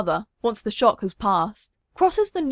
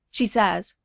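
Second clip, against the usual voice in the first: ordinary delivery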